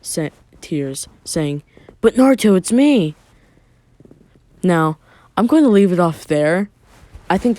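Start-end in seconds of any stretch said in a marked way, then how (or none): 2.0-3.2 louder and higher, a pleading cry; tearful plea. Acted. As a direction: call out to him through tears. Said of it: "Jiraiya breaks into a tear".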